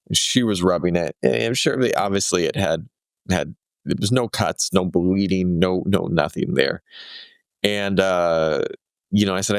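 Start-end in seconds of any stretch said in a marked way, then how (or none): none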